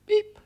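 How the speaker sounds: high-pitched spoken bleep; short, high beep; mock censor beep.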